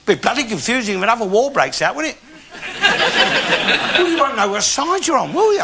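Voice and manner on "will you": normal talking voice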